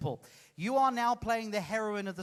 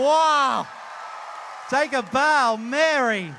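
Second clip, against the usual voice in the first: big, raised showman's cheer; loud acclaim; 4 semitones higher, 9 dB louder, much slower than usual. Real, not acted. Host praises the volunteer after the rehearsal, loudly acclaiming. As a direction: call it out over the applause.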